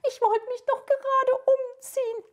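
high, crying princess voice begging; frightened princess. Acted. Captured princess begging the wizard to spare her hair, high crying. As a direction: whimper and plead like a vain young princess.

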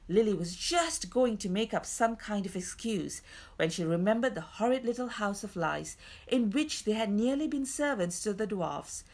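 ordinary delivery, nothing marked

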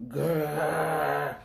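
low growling roar; playful lion growl. Acted. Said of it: "roared Lion".

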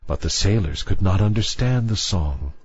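ordinary delivery, nothing marked

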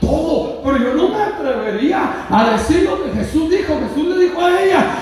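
impassioned, high-pitched sermon delivery; full-force preaching. Acted. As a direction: cry out the story to the congregation. (predicación a todo pulmón)

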